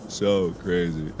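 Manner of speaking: ordinary talk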